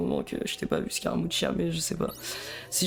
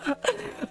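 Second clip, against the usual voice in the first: high-pitched giggle; nervous laugh; 13 semitones higher than usual. Real, not acted. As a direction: let out a high, helpless laugh. Laughs at living his nightmare. (petit rire nerveux aigu)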